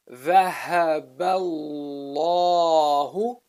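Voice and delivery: slow recitation, long dark "الله"; heavy lam. Shown as a demonstration. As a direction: stretch "الله" loudly with a thick L and long alif. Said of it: "is a heavy pronunciation of the lam".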